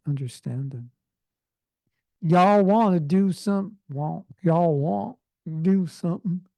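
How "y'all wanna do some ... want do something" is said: put-on teacher hams up a slow American Southern accent; imitating a Southern accent. Teacher imitating Southerners for his students, mock Southern accent. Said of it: "That's how they talk".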